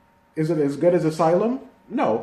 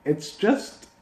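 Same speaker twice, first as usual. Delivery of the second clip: usual speaking voice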